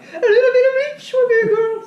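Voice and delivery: very high, loud chef voice, laughing; squeaky put-on cooking show voice; Julia Child voice. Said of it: "and I'm like".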